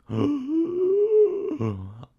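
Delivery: long, high, drawn-out gasp. A narrator's reading. Frightened girl sees the mummy, long exaggerated gasp. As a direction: give a high hammed-up gasp. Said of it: "She let out a low gasp".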